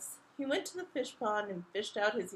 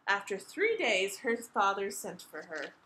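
plain reading voice

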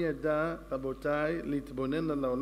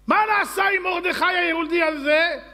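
much higher, much louder demanding shout; royal shout; 14 semitones higher, 12 dB louder than usual. Acted. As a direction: bellow the question across the court. King shouts demanding question. (צעקה מלכותית תובענית)

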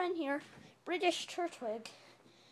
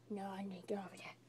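quick, hushed whisper to yourself; hushed aside; 5 semitones lower, 11 dB quieter, faster than usual. Pretend. Answering own call about the missing friend, whispered. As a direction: drop to a fast whispered mutter.

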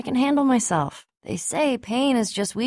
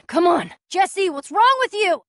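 high, loud, slowed yell; alarmed call; urgent shout; 10 semitones higher, 5 dB louder than usual. Acted. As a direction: shout at him to snap out of it. Urgent shout during escape.

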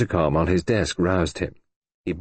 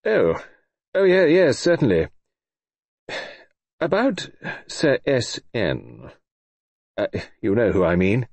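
Sir George; 2 semitones higher than usual. A narrator's reading.

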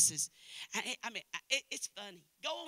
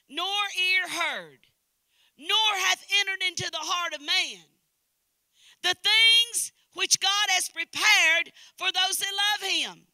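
higher, loud scripture reading with long stresses; preaching at full force; 8 semitones higher, 12 dB louder than usual. Real, not acted. Preacher reading scripture to the congregation, full-force preaching. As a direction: proclaim each phrase like a revival preacher.

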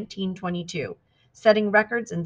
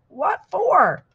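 high-pitched defiant yell; yelled back; 12 semitones higher, 4 dB louder than usual. A narrator's reading. Determined Trudy answering the worried boat crew, yelled back. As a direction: holler it back, voice leaping up. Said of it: "yelled back".

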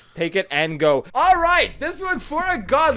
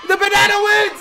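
climbing higher and louder to a yelled result; excited shout; 9 semitones higher, 4 dB louder than usual. Real, not acted. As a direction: shout the winner out. Final roll decides winner.

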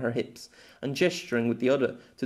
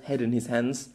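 ordinary delivery, nothing marked

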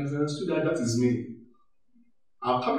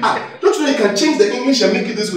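fussy colleague; 10 semitones higher, 7 dB louder than usual. Retold or imitated. Mimics a fussy finisher.

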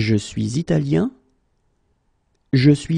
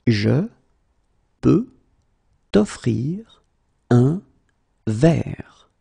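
slow, word by word; much slower than usual. Shown as a demonstration. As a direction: pause after every word. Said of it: "teacher drills word by word".

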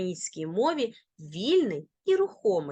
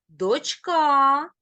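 дочка with long, loud, high ка; stress on last syllable; 5 semitones higher, 6 dB louder than usual. Shown as a demonstration. Teacher demonstrating final-syllable stress. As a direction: hit and stretch ка in дочка. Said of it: "наголос на останній склад".

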